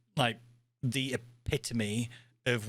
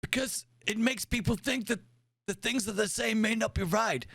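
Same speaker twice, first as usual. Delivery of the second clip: put-on high, insistent old admiral; crotchety old commander explaining; 10 semitones higher than usual. Plays old admiral character.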